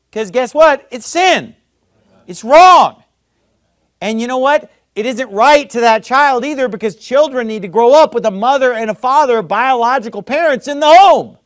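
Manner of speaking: high, loud preaching shout on sin; fiery preaching. Real, not acted. Pastor condemning sin to the congregation, loud fiery preaching. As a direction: thunder it from the pulpit.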